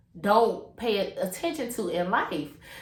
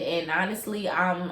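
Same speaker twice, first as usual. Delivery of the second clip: ordinary talk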